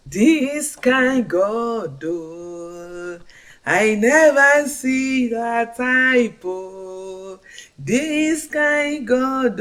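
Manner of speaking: slow, high, loud praise; ecstatic praise. Real, not acted. Woman praising God for her blessing, slow high ecstatic cry. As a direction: cry it out slowly in wonder at God.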